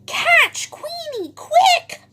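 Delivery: very high, loud duck shout; urgent, piercingly high cartoon squawk; quacking duck. A narrator's reading. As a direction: raise the alarm as a squawking duck. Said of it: "quacks Dottie".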